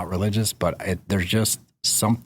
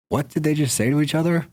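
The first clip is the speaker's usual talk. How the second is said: high, incredulous outburst; shocked disbelief; 9 semitones higher than usual. Retold or imitated. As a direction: say it stunned, pitch jumping up. Disbelief at reality TV line.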